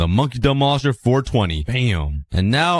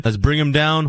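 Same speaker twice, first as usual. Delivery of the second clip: usual speaking voice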